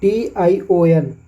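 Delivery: टीआईओएन spelled slowly; slow spelling. Shown as a demonstration. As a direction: spell the ending clearly. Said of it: "जहाँ पर". (धीमे टीआईओएन)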